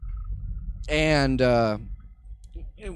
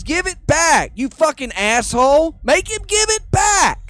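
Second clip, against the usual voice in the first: high, loud whiny outrage; furious shout; 10 semitones higher, 7 dB louder than usual. Retold or imitated. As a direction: demand it back, yelling high. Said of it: "And Joe Rogan says".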